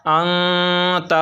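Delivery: ant drawn out on a nasal hum; slow level chant, holding the n of ant; noon hidden in nose. Shown as a demonstration. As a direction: hold the noon in the nose before the t. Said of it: "थोड़ी देर नाक में छुपाकर पढ़ना है".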